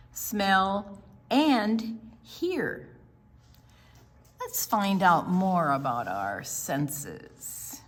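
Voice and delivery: slow, clear picture-book reading; reading to children. Acted. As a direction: read it out to a room of kids. Reads picture book aloud.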